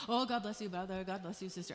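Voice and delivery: low, soft mock-religious greeting; mock pious. Mimicking religious churchgoers greeting each other, mock pious. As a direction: put on hushed churchy piety. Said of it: "not saying to religiously go and say".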